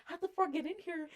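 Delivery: hushed, hurried exchange; quiet mock-panicked question; acted whispering. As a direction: imitate whispering in alarm, softly. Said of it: "and we're whispering".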